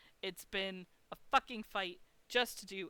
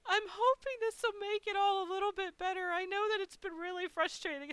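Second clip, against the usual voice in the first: high, sweet, caring voice; comforting sympathy; 11 semitones higher than usual. Retold or imitated. Front desk lady bringing food to frustrated guest, high comforting voice. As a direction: console gently in a raised pitch.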